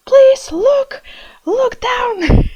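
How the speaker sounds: high, loud mock plea at the screen; begging her past self to look down; pleading with herself. Vlogger urging her past self, high pleading call. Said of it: "It feels like I'm watching this real time".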